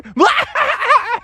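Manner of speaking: high cartoon villain cackle; villain laugh.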